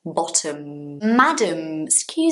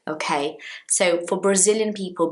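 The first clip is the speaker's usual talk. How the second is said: normal talking voice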